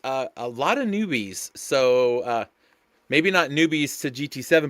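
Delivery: normal talking voice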